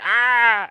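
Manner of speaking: high, loud imitated yell; mock scream. Film fan imitating victims as guts fly, mock scream. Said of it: "them going".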